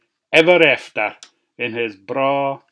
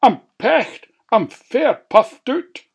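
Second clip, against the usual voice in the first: high, puffed-out wolf; 9 semitones higher, 4 dB louder than usual. A narrator's reading. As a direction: pant like a tired wolf. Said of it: "said the wolf".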